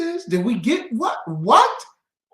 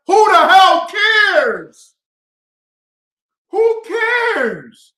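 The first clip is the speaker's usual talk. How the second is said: much higher, loud, slow shout; furious shout; 9 semitones higher, 11 dB louder, much slower than usual. Real, not acted. Dismissing the vote-or-else argument, furious shout. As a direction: yell it with contempt. Angry rant about voting.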